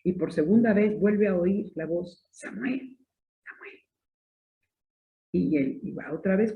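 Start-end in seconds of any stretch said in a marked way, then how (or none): 2.3-3.8 soft, faraway call of the name; a hushed, distant divine call; voice of God calling. Acted. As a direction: call him gently as if from far off. God's voice calls Samuel again.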